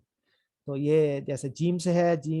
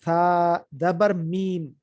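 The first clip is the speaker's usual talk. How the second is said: slow, drawn-out teacher reading; syllable by syllable, slowly; slow spelled reading; 3 dB louder, much slower than usual. Shown as a demonstration. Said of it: "ابھی فی الحال یہ اس طرح پڑھ لیں".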